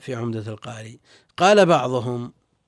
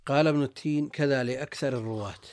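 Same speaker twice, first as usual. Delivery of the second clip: ordinary talk, nothing marked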